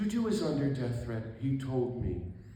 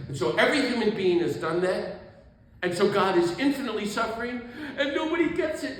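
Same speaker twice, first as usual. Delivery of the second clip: imitated sobbing lament, climbing higher; Tutu; 8 semitones higher, 7 dB louder than usual. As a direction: build to a loud, high outcry of anguish. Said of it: "And he's sobbing".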